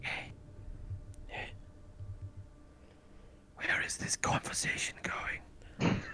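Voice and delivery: slow unvoiced whisper. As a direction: whisper it back slowly. GM whispers back in character.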